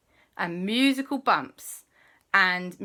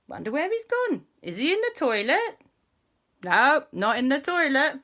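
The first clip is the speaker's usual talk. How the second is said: high searching voice; 8 semitones higher than usual. Acted. Family searching for Gug-Gug.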